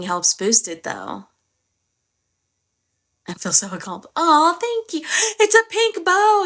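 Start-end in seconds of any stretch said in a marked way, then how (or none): 4.2-6.4 high, thrilled thank-you; excited squeal. Real, not acted. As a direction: gush over the gift. Thanking a viewer for a gift.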